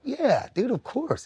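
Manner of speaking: high-pitched, readily agreeing fan; eager agreement. Retold or imitated. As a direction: imitate an understanding fan's quick yes. Fans' understanding reply, acted.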